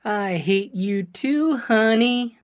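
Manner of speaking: slow, sing-song and teasing; lilting. A narrator's reading. Brian mocks Phil with a slow teasing lilt. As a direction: lilt it back sweetly to mock him. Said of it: "Brian said in a lilting voice".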